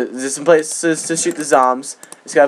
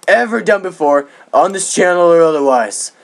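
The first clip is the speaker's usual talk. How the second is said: super cool voice. Pretend. Streamer greeting subscribers before a game, in a super cool voice. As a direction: stretch the key words in a grand, put-on cool voice. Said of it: "stop talking my super cool voice".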